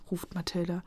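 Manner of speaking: plain reading voice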